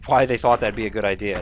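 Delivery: usual speaking voice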